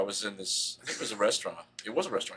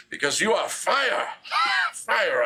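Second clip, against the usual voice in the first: loud, higher, slow proclamation through laughs; dark lord; 10 semitones higher, 5 dB louder, slower than usual. Pretend. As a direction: declare it like a mock-epic king. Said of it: "I was like".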